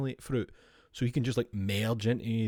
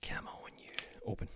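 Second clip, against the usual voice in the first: whisper; 11 dB quieter than usual. Real, not acted. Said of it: "coaxing stuck card".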